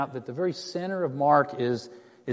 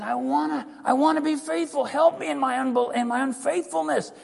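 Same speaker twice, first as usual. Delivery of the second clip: much higher, begging father's cry; desperate plea; 9 semitones higher than usual. Retold or imitated. Father begging Jesus for his son, desperate. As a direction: beg Jesus to help him believe. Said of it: "The guy says".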